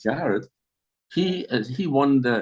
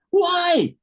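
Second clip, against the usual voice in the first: much higher and louder, protesting; indignant why; 5 dB louder than usual. Retold or imitated. Relatives objecting to the English rule, high loud protest.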